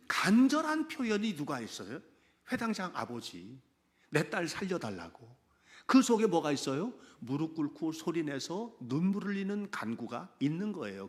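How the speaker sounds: normal talking voice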